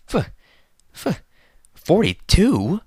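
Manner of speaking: horrified disbelief, stumbling on the number; stammering in shock. A narrator's reading. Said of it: "his eyes widening in shock".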